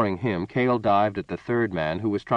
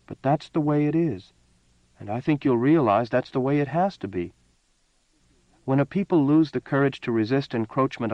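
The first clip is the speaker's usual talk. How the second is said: lightly voiced woman, a touch higher and earnest; Lucy's earnest voice; 5 semitones higher than usual. A narrator's reading. A woman patiently explaining to a disappointed man. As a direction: she explains firmly but kindly. Lucy's long speech to Cale.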